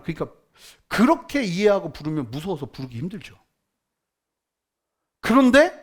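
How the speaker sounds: ordinary talk, nothing marked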